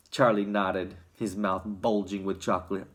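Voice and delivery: ordinary delivery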